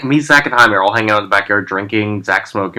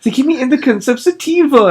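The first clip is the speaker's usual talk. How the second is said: much higher, whiny imitation of a crushed friend; mock heartbroken complaint; 12 semitones higher than usual. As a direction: mimic a friend devastated over weed. Friend upset over wrong weed.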